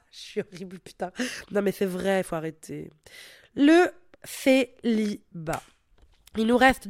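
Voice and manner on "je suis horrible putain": soft laugh through the words; laughing at herself. Real, not acted. Podcaster laughing at her own bossy joke, soft laugh. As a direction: giggle it out, quieter, amused at yourself.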